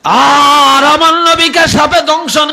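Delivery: high, slow, stretched-out exclamation; impassioned preacher's cry. Real, not acted. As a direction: cry it out to the crowd, drawing out the words. Preacher cries out danger to the Prophet. (উঁচু গলায় টেনে টেনে ওয়াজের আর্তি)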